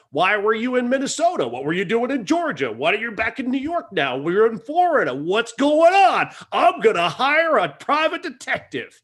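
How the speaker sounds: high-pitched, hammed-up interrogation; mock-indignant questioning, rising to high outrage; nagging manager. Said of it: "Vincent Gardenia plays the Yankees manager ... just wants to know".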